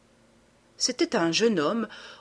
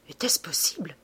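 chuchoté; 2 semitones lower, 4 dB quieter than usual. A narrator's reading. Narrateur frissonne, incrédule.

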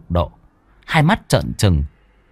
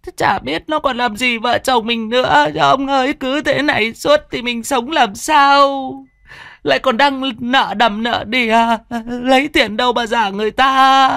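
wife lamenting. Acted. Wife lamenting debts and haunting.

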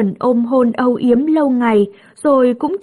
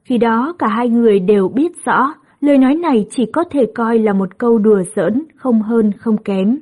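usual speaking voice

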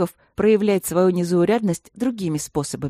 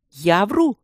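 louder, offended outrage; hammed-up indignation; indignant cry; 3 semitones higher, 5 dB louder than usual. A narrator's reading. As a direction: throw it back in protest. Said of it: "возмущённо воскликнул".

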